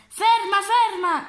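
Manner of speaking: loud high shout after the ferry. Acted. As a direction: yell it at the departing boat. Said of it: "gridò il signore". (grido forte e acuto)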